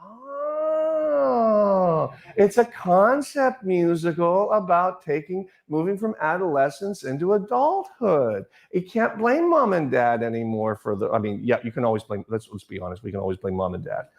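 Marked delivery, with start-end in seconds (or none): 0.0-11.1 high, slow eureka moment; dawning realization. Retold or imitated. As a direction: savor the discovery, drawn out. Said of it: "And I went".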